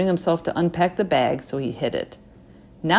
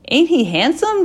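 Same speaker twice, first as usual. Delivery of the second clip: excited grandma; 10 semitones higher, 6 dB louder than usual. A narrator's reading. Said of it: "Jima said".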